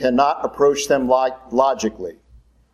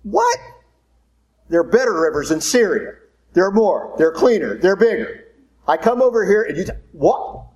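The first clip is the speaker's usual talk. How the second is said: high, indignant rant of a proud officer; ballistic outburst; 6 semitones higher than usual. Retold or imitated. Naaman ranting to Elisha about Syria's rivers, outraged. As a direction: raise the pitch in outrage, sputtering.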